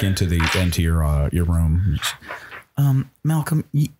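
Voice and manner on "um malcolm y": hesitant Bonnie. Acted. Game master voices Bonnie warning Malcolm.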